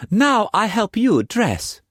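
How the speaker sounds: put-on high, loud playful young woman; Mei Mei's voice. A narrator's reading. Mistress teasing Struan in bed, high playful voice. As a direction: tease him in a high bright voice.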